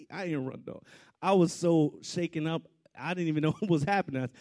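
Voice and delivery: usual speaking voice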